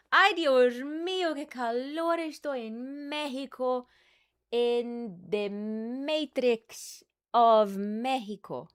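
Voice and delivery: high, loud, theatrical Spanish-tinged wail; hammed-up heat lament. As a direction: moan about the heat like a telenovela star. Mock dramatic heat complaint.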